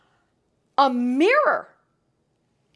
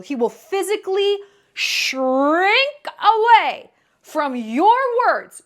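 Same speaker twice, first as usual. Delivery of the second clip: normal talking voice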